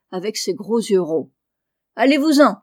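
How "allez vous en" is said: sudden loud parrot squawk, higher and much louder; parrot cry. A narrator's reading. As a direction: screech it like a rude parrot. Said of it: "lui cria-t-il tout à coup".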